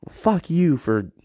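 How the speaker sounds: high, loud and slow, spitting the words out; angry outburst. Acted. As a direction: lash out at someone for what they said. Angry retort acted in a quote.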